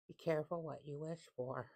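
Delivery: normal talking voice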